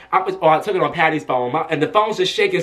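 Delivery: usual speaking voice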